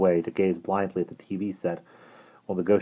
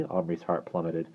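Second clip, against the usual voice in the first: ordinary delivery, nothing marked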